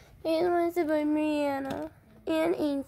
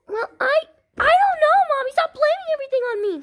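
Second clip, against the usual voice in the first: put-on high loud protesting child; whiny kid; 14 semitones higher, 10 dB louder, faster than usual. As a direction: whine against the blame.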